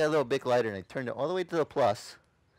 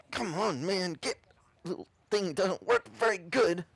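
higher, effortful acted muttering; strained struggle; 7 semitones higher than usual. Convert fighting to relight lighter, strained struggle. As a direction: talk through the effort of wrestling.

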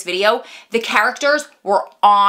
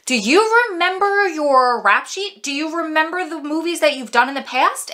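host voice; 9 semitones higher than usual. Retold or imitated. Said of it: "he said to [name]".

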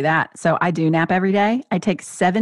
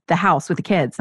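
ordinary talk

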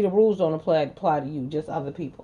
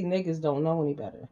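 ordinary delivery

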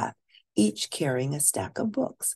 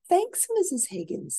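lightly voiced girl, higher and eager; young princess voice. Sophia asking the librarian for books, eager girl voice. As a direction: ask like a keen young girl.

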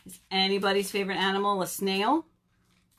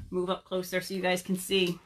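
usual speaking voice

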